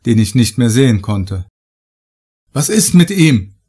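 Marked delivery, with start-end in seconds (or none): 2.5-3.5 higher, louder call across the distance; calling back. Acted. Narrator calls back to the foreman about his friend, loud call. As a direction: call out to someone you can't see. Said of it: "rief ich zurück".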